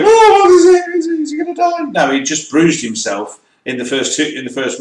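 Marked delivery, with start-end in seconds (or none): 0.0-1.8 high, squealing mock panic. Mimicking everyone fussing over Lando in high panic. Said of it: "they all go".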